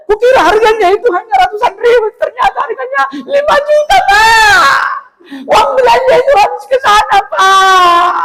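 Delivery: very high, loud fake sobbing, fast; mock wailing wife. Wife confessing five million spent, mock crying wail.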